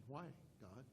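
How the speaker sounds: hushed, near-silent plea to God; a quiet, aching why; pleading question. Retold or imitated. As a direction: ask it softly, as if worn out. Joseph's imagined plea to God.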